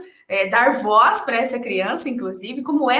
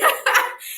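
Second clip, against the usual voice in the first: high embarrassed laugh; 13 semitones higher than usual. Real, not acted. Laughing at own confession.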